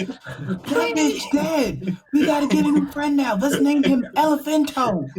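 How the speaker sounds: loud gleeful outburst; excited. Pretend. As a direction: crow it out triumphantly. Celebrating the kill loudly.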